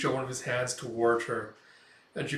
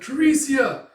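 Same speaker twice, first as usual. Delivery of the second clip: high, loud shout of a name; drawn-out alarmed yell; crying out; 13 semitones higher, 10 dB louder, slower than usual. Retold or imitated. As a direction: cry out in horror. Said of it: "as she cries out".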